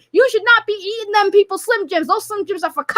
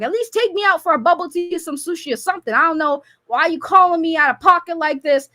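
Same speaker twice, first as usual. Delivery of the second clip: ordinary talk, nothing marked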